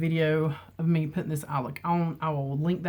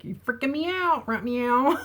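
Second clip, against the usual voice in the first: teasing her husband with the catchphrase, playfully higher; 5 semitones higher than usual. Retold or imitated. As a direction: tease the hubby with a lifted right meow. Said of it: "It's like".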